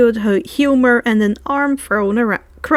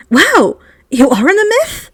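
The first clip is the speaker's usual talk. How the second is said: higher, louder wonder; amazed; 5 semitones higher, 5 dB louder than usual. Acted. Hermione learns Air is a mythical creature, amazed and louder. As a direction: marvel at the discovery.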